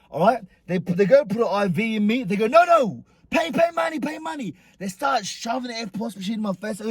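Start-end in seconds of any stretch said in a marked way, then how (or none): 2.5-4.6 high, pestering mock voice; imitated nagging demand in raised pitch; insistent demand. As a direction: mimic staff pushing hard for payment. Acting staff demanding money.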